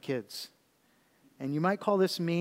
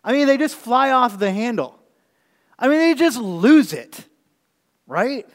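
louder and higher, building in force; heated preaching; 7 semitones higher, 9 dB louder than usual. Acted. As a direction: preach it hard about people who fly off the handle. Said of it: "a person who loses their temper".

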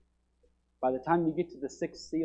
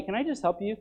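ordinary delivery

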